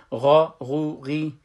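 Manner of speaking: heavy throaty gh on غا with a back vowel; emphatic ghayn. Shown as a demonstration. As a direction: open غا full and loud, then ease into غو، غی. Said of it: "این مفخم است". (غا پر و مفخم، سپس غو، غی)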